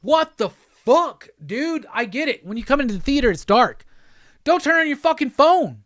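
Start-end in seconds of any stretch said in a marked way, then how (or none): 0.0-1.9 exasperated outburst. Real, not acted. Ranting at phone users in theater. 4.4-5.8 higher, louder stern order; scolding command. Real, not acted. Telling moviegoers off, angry and loud.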